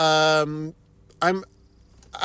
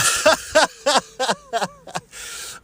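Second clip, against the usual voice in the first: a sudden high giggle at herself; 11 semitones higher than usual. Real, not acted. Telling viewers about pizza, amused high giggle. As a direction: laugh at your own joke about pizza. Laughing at own joke.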